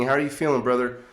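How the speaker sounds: ordinary delivery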